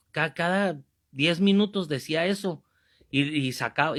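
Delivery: usual speaking voice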